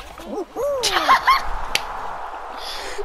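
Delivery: high-pitched drawn-out laughing fit; high cackle. Real, not acted. Laughing after double homicide.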